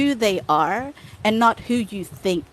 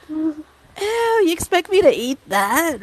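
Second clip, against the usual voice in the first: higher-pitched, mock-revolted objection; disgusted disbelief; 6 semitones higher, slower than usual. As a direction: relive the disgust, incredulous. Retelling disgust at being offered balut. (nandidiring hindi makapaniwala)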